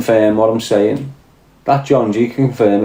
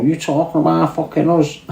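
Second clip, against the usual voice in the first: lightly put-on Irish accent, higher and offended; a hint of an Irish accent, higher, mock offended; Irish locals' retort; 5 semitones higher than usual. To Irish viewers, a lightly voiced Irish local, mock offended. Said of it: "these people in Ireland go".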